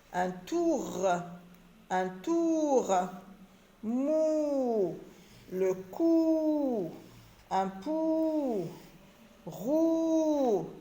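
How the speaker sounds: sing-out long "ou" on "tour" through "roue"; elongated vowel. Shown as a demonstration. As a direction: hold the "ou" long in "tour", "Mou", "cou", "pou", "roue". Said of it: "on allonge un petit peu le son".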